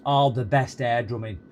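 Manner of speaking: ordinary delivery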